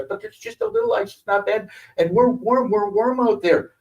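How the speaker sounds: put-on high, whiny kid protest; child begging mom; whiny pleading.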